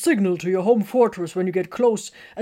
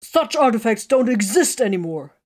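higher, louder protest; indignant protest; 4 semitones higher, 5 dB louder than usual. A narrator's reading. Tiberius denying such artifacts exist, loud protest. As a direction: insist it can't be true. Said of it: "Tiberius burst out".

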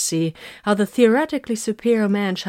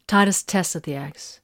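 ordinary delivery

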